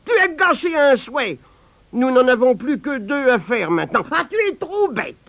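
high, loud furious rant; wife rebuking her husband; angry scolding. A narrator's reading. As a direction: berate him for wasting a wish.

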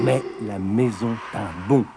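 ordinary delivery, nothing marked

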